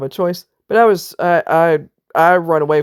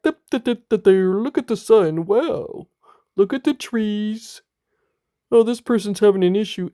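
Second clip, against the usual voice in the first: higher, dopey gawking voice, mocking someone who ignores you; mocking oblivious bystander; 5 semitones higher than usual. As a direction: play the clueless onlooker staring at scenery. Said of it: "just looking at you like".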